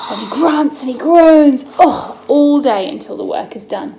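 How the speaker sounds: effortful storytelling. Acted. Mum reading to son, straining effort voice. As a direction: push the words out as if lifting stones.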